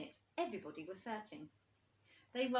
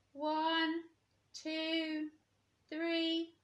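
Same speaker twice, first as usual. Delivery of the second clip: higher, louder counting; counting aloud; 9 semitones higher, 6 dB louder, much slower than usual. A narrator's reading. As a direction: count out for hide and seek. Old Bear counting in game.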